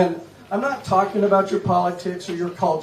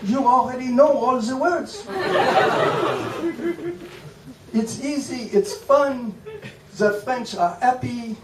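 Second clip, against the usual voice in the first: higher, hammed-up French accent held through; mock French accent; 5 semitones higher than usual. Shown as a demonstration. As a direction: keep up the silly French accent. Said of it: "you simply speak like this".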